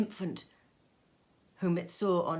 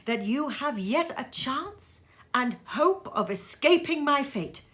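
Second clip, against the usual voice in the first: a higher, louder voice for the ghost of Marley, solemn and warning; the dead partner's grave warning, raised and intoned; Marley's ghost; 6 semitones higher, 6 dB louder than usual. Acted. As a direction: ghost voice delivering a dire warning, pitched up. Marley's ghost warns Scrooge.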